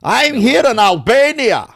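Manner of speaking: high, loud put-on Serbian-accented vlogger voice; impression of Balkan Dad. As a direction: ham up a Serbian Borat. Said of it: "I got his fucking goddamn voice perfect".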